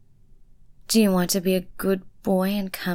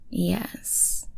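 normal talking voice

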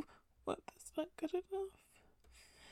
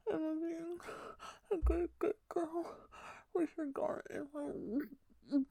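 louder, sobbing begging; desperate crying plea; 2 semitones higher, 4 dB louder than usual. Acted. Pleading climbs into crying.

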